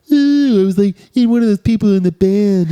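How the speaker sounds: high Muppet band-member voice. Pretend. Player joking about being in the Muppets band, Muppet-like voice. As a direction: put on a high puppet voice. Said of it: "I sound like a Muppet".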